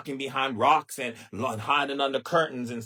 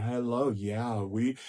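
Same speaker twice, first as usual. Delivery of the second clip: ordinary talk, nothing marked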